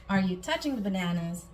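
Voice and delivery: ordinary talk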